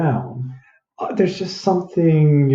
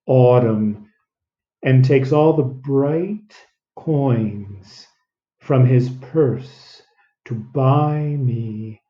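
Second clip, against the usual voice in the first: slow, softening, over-enunciated; exaggerated slow reading; slower than usual. Shown as a demonstration. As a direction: drag out each word deliberately. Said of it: "It's always good to exaggerate things".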